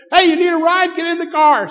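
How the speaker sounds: high, loud, eager offer; calling out. Retold or imitated. Dad calling the boys into the car, loud high call.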